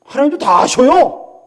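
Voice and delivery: ordinary delivery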